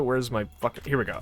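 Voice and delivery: normal talking voice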